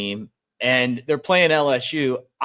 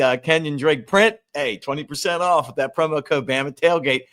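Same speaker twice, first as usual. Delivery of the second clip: ordinary talk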